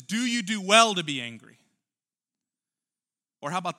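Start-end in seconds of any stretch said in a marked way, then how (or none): 0.0-1.6 loud, emphatic challenge; preached question. Shown as a demonstration. Preacher challenging the listeners, loud and pointed. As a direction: press the question on the congregation. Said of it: "The question is".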